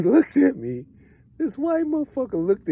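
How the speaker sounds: high, loud, laughing disbelief; laughing in amusement. Real, not acted. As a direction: crack up while telling it, pitch shooting up.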